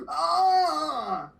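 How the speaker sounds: high sudden cry of awe; shocked cry. Retold or imitated. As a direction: yelp in amazement. Retold reaction at wrestling.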